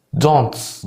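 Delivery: "دونتس" with a strong s after the t; exaggerated final s. Shown as a demonstration. Teacher mimicking Liverpool speakers, exaggerated s on "دونتس". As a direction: overdo the s closing "دونتس". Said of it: "بيبالغوا قوي في السين اللي في الاخر".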